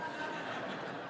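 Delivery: a quiet, voiceless chuckle; soft breathy laugh. Real, not acted. Priest recalling waking his classmate, stifled laugh. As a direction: laugh under the breath at the memory.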